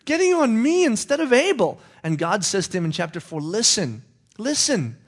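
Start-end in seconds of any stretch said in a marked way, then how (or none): 0.0-1.8 high, loud indignant complaint; Cain's high, loud protest; angry protest. Acted. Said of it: "He gets mad". 3.4-5.0 high, firm lecturing voice; high-voiced God scolding.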